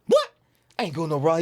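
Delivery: high-pitched mock indignation; riled up. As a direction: imitate a riled-up man objecting. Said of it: "he was getting so riled up".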